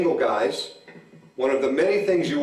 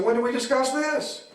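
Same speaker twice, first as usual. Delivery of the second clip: surprised protest; 10 semitones higher than usual. Retold or imitated.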